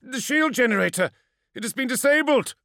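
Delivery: high, loud urgent report; excited. Acted. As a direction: burst out with the news. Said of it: "excited exclamation".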